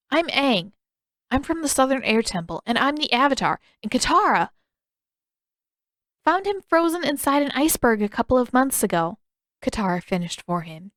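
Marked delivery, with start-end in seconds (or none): 0.1-9.2 put-on higher, eager young boy voice; eager kid introducing himself. A narrator's reading. Aang introduces himself to the chief, higher and eager.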